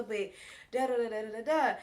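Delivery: usual speaking voice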